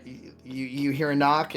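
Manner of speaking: ordinary delivery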